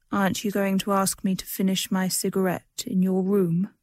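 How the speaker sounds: ordinary delivery, nothing marked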